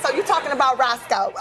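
ordinary delivery

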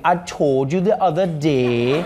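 put-on slow, lilting Trinidadian accent; Trinidadian sing-song. Host demonstrates to Trinidadians their sing-song accent. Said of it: "the sing-song vibe when you guys speak".

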